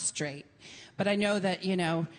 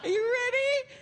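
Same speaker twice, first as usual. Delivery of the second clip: much higher, squealing hype; excited announcer. Retold or imitated. To her party guests, squealing with excitement. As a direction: whip the party guests into a frenzy. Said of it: "and I'll be like".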